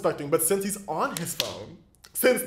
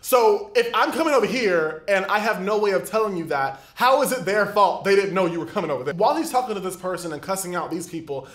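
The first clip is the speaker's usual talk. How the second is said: ordinary delivery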